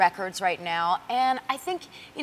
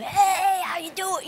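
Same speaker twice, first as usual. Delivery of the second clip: lightly voiced rocker hello, pitched up; 8 semitones higher, slower than usual. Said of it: "the way he is".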